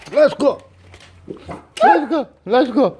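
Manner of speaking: insistent outdoor call; calling dogs. Real, not acted. Calling dogs to come outside.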